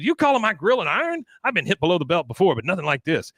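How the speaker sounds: high, fast mock outrage; mock offended Foreman. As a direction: act wounded by the insult. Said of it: "He goes".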